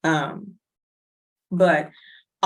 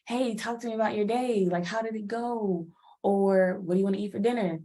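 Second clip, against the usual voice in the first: lightly higher, chatty partner asking about your day; a hint of an eager partner, pitched up; partner's voice; 4 semitones higher than usual. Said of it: "your partner's like".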